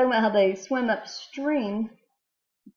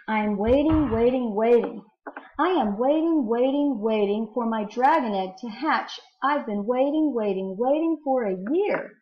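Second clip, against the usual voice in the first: louder, lifted, rhythmic verse reading; poem reading; 3 semitones higher, 5 dB louder than usual. Acted. Teacher reading a dragon egg poem to students, big rhythmic read-aloud. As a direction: read it out big, leaning on each repeated word.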